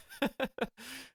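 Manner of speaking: quick airy giggle; a soft laugh escaping; breathy giggle. Real, not acted. Giggling at the character's name, airy laugh.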